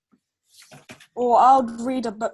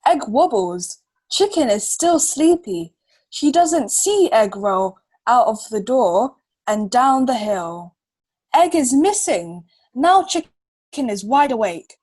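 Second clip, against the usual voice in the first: lively, louder storybook reading; animated picture book read-aloud; reading to a child; 10 dB louder than usual. Acted.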